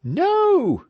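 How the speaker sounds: high, loud shriek. A narrator's reading. The whole crowd agreeing with Ulrich, loud high shriek. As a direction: everyone shrieking at once. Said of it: "shrieked everybody".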